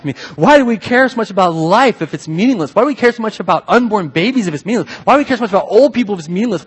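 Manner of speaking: usual speaking voice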